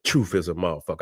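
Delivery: soft gloating under the breath; low knowing murmur. Real, not acted. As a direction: savor it quietly to yourself. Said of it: "I say".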